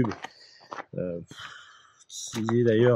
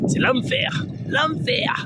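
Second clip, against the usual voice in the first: in ice cold water; much slower than usual. Real, not acted. Shrieking in freezing lake.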